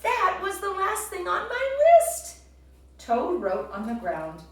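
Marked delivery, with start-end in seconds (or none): 0.0-2.4 much higher, loud and thrilled; excited shout. A narrator's reading. Toad tells Frog, excited and shouting. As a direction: cry it out in delight. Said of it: "shouted Toad".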